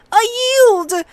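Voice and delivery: high-pitched cry of surrender; shrill, high yelp. A narrator's reading. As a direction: squeal it out high, like a beaten boy. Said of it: "he shrilled".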